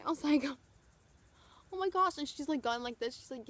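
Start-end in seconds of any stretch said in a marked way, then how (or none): 1.7-2.2 high, loud thrilled gasp; starstruck gasp. Retold or imitated. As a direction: squeal it in delight. Fan reacting, starstruck.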